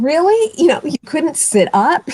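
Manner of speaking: high, loud disbelieving "Really?"; incredulous. Retold or imitated. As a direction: scoff in high-pitched disbelief. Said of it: "And I'm thinking".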